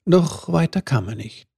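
ordinary delivery

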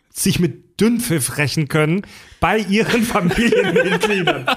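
words broken by laughter; laughing. Real, not acted. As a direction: laugh your way through it. Laughing through the sentence.

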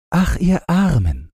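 put-on high, pitying old woman; high sympathetic crone voice; old Lapp woman. A narrator's reading. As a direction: pity the poor travellers. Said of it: "sagte die Lappin".

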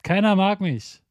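put-on high, childish insult; mock schoolyard jab. Shown as a demonstration. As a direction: fling it like a kid in a fight.

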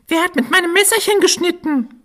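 high squeaky little dwarf. Acted. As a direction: pipe it up like a tiny dwarf. Dwarf asks about his knife.

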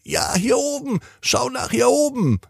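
sehr hoch und sanft rufend; hohe Sternenstimme. Acted. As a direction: wie ein Stern aus der Ferne, hoch und leise. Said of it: "Und wieder ruft es". (ein Stern ruft Tina sanft durchs Dachfenster, sehr hoch)

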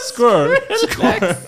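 Squirrel hoch und lachend nachgeäfft; äffe Joriks Squirrel lachend nach; Jorik nachgemacht, lachend. Retold or imitated. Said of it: "Jorik sagt so die ganze Zeit". (Joriks Squirrel lachend und hoch nachgeäfft)

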